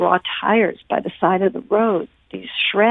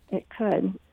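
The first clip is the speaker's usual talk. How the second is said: softer reflective repeat; quiet echo; 8 dB quieter than usual. Real, not acted. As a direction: say it again to yourself. Soft echo of her answer.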